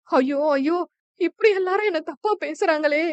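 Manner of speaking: high, wailing cry of despair; wailing lament. A narrator's reading. Widow wails at the panchayat, high and tearful. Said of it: "தலையில் அடித்து அழ ஆரம்பித்தாள்".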